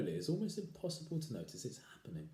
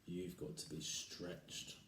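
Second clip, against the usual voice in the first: sinister hush; 3 semitones lower, 10 dB quieter, much slower than usual. Acted. Husband sinister.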